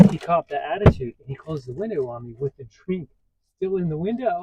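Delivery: normal talking voice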